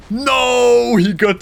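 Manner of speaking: high, drawn-out cry of dismay. Real, not acted. Player sees his boat get latched, dismayed cry.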